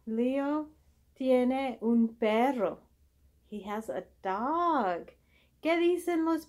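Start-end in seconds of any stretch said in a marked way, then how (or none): none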